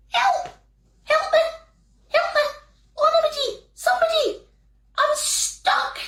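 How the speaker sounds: very high, squeaky little-man voice calling help; tiny trapped fairy squealing for help; a wee man stuck and crying out. Acted.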